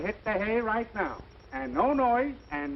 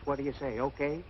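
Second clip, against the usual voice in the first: usual speaking voice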